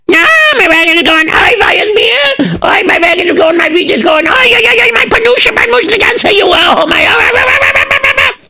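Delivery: panicked wailing. Acted. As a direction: wail for the stolen wagon. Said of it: "he panicked and he was screaming".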